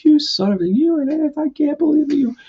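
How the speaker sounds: high, shrill-edged mock fury; squealing retold rage at her brother; sister's outburst. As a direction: imitate a kid sister losing it. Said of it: "She goes".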